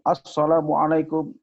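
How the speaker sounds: rally the field crowd with a stretched greeting; spirited orator. Shown as a demonstration. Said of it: "dia lebih bersemangat".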